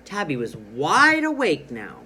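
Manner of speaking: loud, excited storybook exclamation; reading with excitement. Shown as a demonstration. Teacher models an exclamation point for pupils, loudly excited. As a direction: swell excitedly on "wide awake". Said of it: "I read that sentence with excitement".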